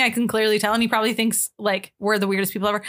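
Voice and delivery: ordinary talk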